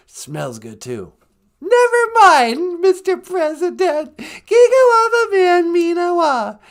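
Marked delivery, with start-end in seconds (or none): 1.6-6.6 high, loud, drawn-out presidential sign-off; loud, high-pitched hammed-up president impression; George W. Bush. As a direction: shout it slowly in a much higher put-on voice. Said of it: "He started sounding an awful lot like George W. Bush".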